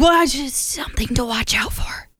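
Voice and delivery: hushed, sheepish whisper. Retold or imitated. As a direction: whisper the excuse. Boyfriend hedges about the cat.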